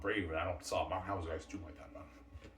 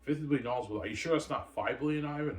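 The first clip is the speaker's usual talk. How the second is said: high, loud shocked disbelief; imitating a shocked woman; 6 semitones higher, 4 dB louder than usual. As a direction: gasp it out like she can't believe the number. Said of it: "She's shocked, V J".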